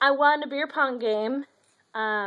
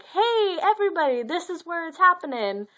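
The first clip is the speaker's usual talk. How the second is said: calling out; 6 semitones higher, 4 dB louder than usual. Pretend. Banner personified calling out.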